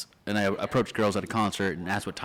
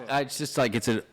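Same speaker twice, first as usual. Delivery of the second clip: ordinary talk, nothing marked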